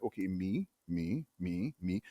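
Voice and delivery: quiet recognition. Retold or imitated. Reading the job description.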